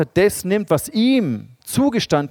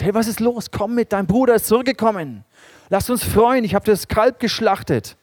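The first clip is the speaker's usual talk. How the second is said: pleading father; 8 semitones higher, 4 dB louder than usual. Retold or imitated. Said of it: "Vater herausgehen muss zu ihm und sagen muss".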